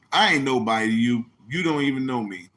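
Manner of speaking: normal talking voice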